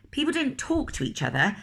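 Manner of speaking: usual speaking voice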